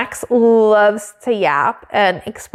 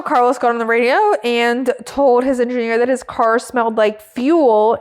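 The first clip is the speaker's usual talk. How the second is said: ordinary delivery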